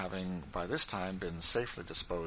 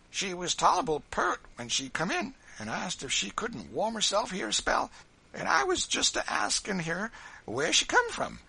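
put-on high-pitched old servant in dialect; compassionate Dinah; 11 semitones higher, 5 dB louder than usual. A narrator's reading. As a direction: explain with pity, voice pitched way up. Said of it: "said old Dinah compassionately".

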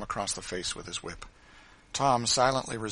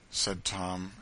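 ordinary delivery, nothing marked

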